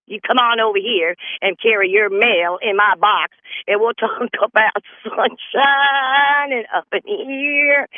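suggestive backwoods neighbor woman; flirty country accent. Retold or imitated. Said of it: "this how we talk like up in here".

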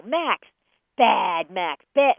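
loud, sharp telling-off of a dog; negative scolding. Shown as a demonstration. Owner shows how not to use the dog's name, loud scolding. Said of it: "use the dog's name in a negative light".

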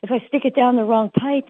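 high, mock-panicked owner; hammed-up worry about the pill. Dog owner fretting to the trainer, mock panic. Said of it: "they're like".

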